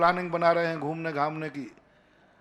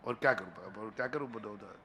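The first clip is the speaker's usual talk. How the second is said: low, much softer aside; helpless resignation; 8 semitones lower, 12 dB quieter than usual. Real, not acted. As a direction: sink into a quiet, helpless appeal. Preacher gives up persuading listeners.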